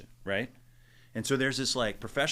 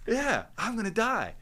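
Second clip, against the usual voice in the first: mock panic; 11 semitones higher, 4 dB louder than usual. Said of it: "I'm like".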